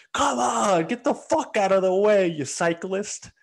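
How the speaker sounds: high, loud angry yell out a car window; mock driver yelling. Driver yelling out his window at a cyclist, high angry shout. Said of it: "he's, like, sticking his head out the window".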